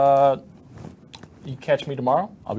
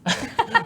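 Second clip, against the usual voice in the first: high giggling laugh; burst of laughter; 5 dB louder than usual. Real, not acted. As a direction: laugh out loud at the pun. Hero pun laughter.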